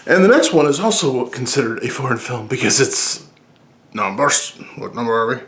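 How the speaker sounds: ordinary talk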